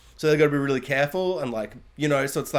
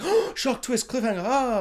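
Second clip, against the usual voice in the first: much higher, sarcastic fake surprise; hammed-up surprise; mock shock; 8 semitones higher than usual. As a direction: gasp it out, mocking a cheap twist. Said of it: "you're just like".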